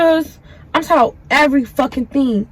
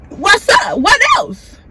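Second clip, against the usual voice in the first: mock yell; 8 semitones higher, much slower than usual. Retold furious reply to the girl.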